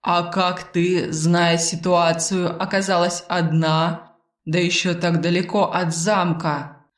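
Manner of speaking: slow, measured voice with stretched words; an unseen companion's leisurely drawl; Arvil, calm bodiless companion. Acted. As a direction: speak unhurriedly, lingering on each phrase.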